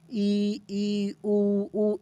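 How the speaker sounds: ई and उ held separately, mouth nearly closed; soft, sustained ई, उ through a tiny opening; close vowels. Shown as a demonstration. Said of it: "एकदम छोटू सा बन रहा है". (छोटे मुंह से ई, उ)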